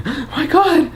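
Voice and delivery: high-pitched fretful bystander voice; hammed-up high voice of aghast onlookers; shocked onlookers. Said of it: "everyone looking around like".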